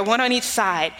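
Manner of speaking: ordinary talk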